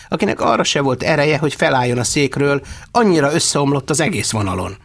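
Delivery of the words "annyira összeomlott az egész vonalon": usual speaking voice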